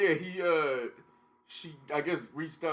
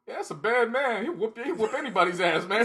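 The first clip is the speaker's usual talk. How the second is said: 1 hyped warning; 9 semitones higher, 5 dB louder than usual. Retold or imitated. Retold warning to Connection.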